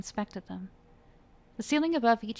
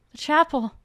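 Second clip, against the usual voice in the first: high, loud last cry; desperate cry; 8 semitones higher, 10 dB louder than usual. Acted. Dying Luca calls his last words, high desperate cry. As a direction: gasp it out.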